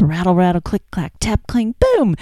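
read-aloud lap game, playful; playful sound words. Shown as a demonstration. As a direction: tease a toddler on your lap with the sounds. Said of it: "having your child sit on your lap".

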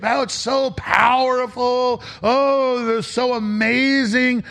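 mock gloating elite. Host mocking globalists who relish the carnage. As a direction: drawl it like a villain relishing the show.

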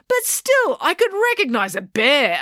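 high-pitched, stubborn protest; insistent. A narrator's reading. April defends her bear sighting, insistent. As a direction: insist against all objections. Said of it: "April insisted".

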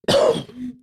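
sputtering cough after swallowing wrong; coughing up water. Real, not acted. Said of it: "still fighting where that water went down the".

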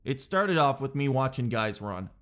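normal talking voice

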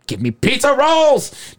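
high, drawn-out insistent cry; drunken demand. Retold or imitated. Drunk brother to sister in the car, high drawn-out demand.

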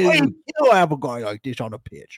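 hammed-up football-fan British accent; British fan cheering a player; mock British accent. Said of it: "Harry Jowsey accent".

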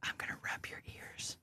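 very hushed, teasing whisper; ASMR whisper. Pretend. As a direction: whisper right into the listener's ears. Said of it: "Don't you A S M R me".

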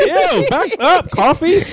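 high-pitched, drawn-out squeal of disgust; mock disgust. Student reacting to teacher's coffee breath, mock disgust.

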